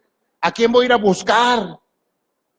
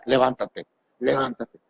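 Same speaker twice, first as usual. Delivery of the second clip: mumbled command; 7 semitones lower, 6 dB quieter than usual. Pretend. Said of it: "dile al de al lado".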